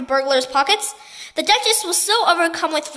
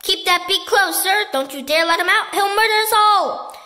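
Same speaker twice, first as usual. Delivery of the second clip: bellowing; 6 semitones higher, 5 dB louder than usual. A narrator's reading. Duke ordering the pelican to keep its beak shut, bellowing. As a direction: roar the order in alarm. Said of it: "he bellowed".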